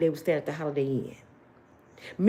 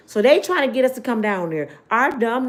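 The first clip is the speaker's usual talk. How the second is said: ordinary talk, nothing marked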